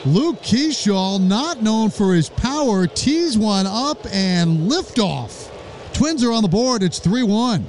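rising, thrilled play-by-play shout; excited home run call. Real, not acted. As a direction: call the homer with mounting excitement. Home run call, live broadcast.

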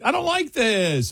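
high, drawn-out mock complaint; acted-out dislike. Driver voicing dread on the drive in, high drawn-out complaint. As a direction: stretch it out high, falling at the end.

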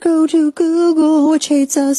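high, loud sarcastic tone; sarcastic sing-song. Pretend. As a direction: sing it out mockingly.

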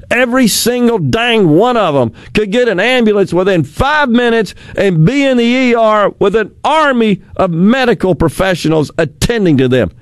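ordinary talk

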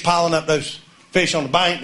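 ordinary delivery

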